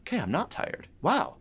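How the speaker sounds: be pleasantly baffled at the energy; surprised Jack. Retold or imitated. Jack after drinking the elixir, mild surprise. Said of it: "it was like".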